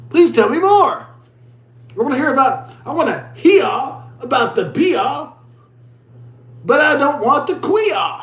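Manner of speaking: high, hammed-up imitation Aussie; teasing put-on Australian accent; mock Australian accent. Acted quote mimicking the Australian pronunciation.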